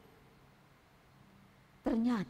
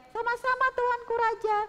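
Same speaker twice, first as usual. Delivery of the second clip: queen's voice; 12 semitones higher than usual. Acted. Queen replying.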